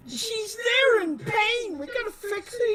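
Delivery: high, pleading cry; distressed pleading. Acted. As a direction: plead with rising panic. Urgent plea for injured ally.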